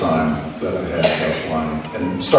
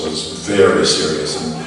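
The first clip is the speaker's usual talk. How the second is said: ordinary delivery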